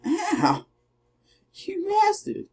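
hammed-up hurt cry; mock outrage. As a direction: yelp it in outrage. Taking a hit in game.

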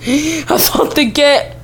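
talking through a real laugh. Real, not acted. Player relieved the game didn't crash, laughing. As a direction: break into laughter mid-sentence.

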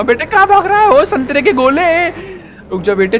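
high, loud then slow taunting; mock taunt. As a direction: taunt the fleeing rival. Taunting chase of rival.

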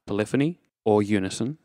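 ordinary talk, nothing marked

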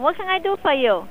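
ordinary delivery

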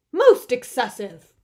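loud, disapproving Bear; indignant protest. A narrator's reading. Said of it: "he protested".

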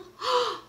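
sharp high gasp of wonder; surprised gasp. Acted. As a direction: gasp as if spotting something. Surprised gasp while reading.

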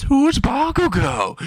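very high, drawn-out question; hammed-up high-pitched question; mock outcry. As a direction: squeal it slowly in disbelief. Acted baffled question from the guy.

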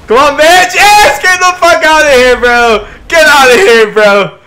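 loud, high triumphant yelling; excited shout. Real, not acted. Player cheers as rival goes, real high shouting. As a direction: yell it at full volume, high-pitched. Wins the struggle in game.

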